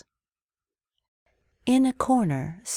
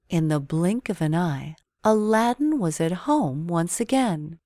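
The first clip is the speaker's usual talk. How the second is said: plain reading voice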